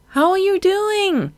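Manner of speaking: high call above the gale; shouted over wind. A narrator's reading. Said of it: "Marina shouted above the wind".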